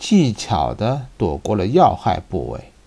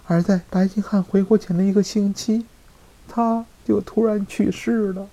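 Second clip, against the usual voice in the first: feigned sorrow; 8 semitones higher than usual. Acted. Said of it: "米莱迪故作忧伤地说".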